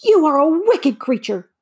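put-on high, loud outraged girl; indignant cry. A narrator's reading. Dorothy accuses the witch, crying out high and indignant. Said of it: "cried Dorothy".

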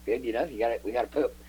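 ordinary delivery

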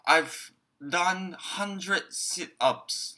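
very slow, each word laid out clearly; slow model sentence. Shown as a demonstration. Teacher to students, a very slow clear model line. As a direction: spell it out slowly for learners. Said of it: "文章に入れてやってみましょう".

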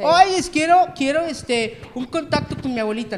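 high, loud pleading client voice; pushy client. Retold or imitated. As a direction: ham up a needy client begging. Mimics clients asking for contact.

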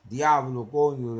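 loud Dominican curse; exclamación fuerte; Spanish exclamation. Retold or imitated. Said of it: "I was like".

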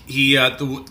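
ordinary talk

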